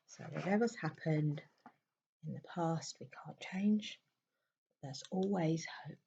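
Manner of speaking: soft meditation voice with long pauses; hushed, spacious meditation guidance; guided meditation. Real, not acted. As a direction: guide a listener gently into stillness, leaving long silences.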